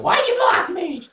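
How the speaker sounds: high-pitched mock whine of protest; pissy protest. Blocked fan complaining to his friend, pissy. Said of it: "you'll probably get all pissy".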